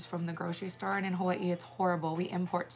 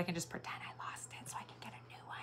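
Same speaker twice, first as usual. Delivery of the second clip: quick, whispered confession; hushed, hurried aside; sneaky whisper; 13 dB quieter, faster than usual. Pretend. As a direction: whisper it fast, like a secret plan. Sneaky aside about faking loss.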